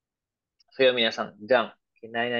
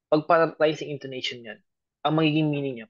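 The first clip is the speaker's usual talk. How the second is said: normal talking voice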